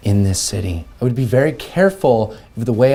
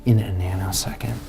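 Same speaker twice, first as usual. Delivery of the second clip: soft threatening whisper; menacing whisper; 3 semitones lower, 7 dB quieter than usual. Acted. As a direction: lean in and hiss the threat. Rude customer menacing the clerk.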